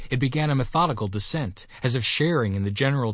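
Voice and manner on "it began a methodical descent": ordinary delivery, nothing marked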